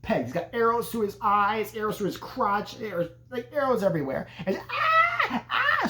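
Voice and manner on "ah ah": very high falsetto screams; mock screaming. Alice finding Bill's body, high mock screams. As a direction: shriek twice, imitating a horror-movie victim. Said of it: "So she starts screaming".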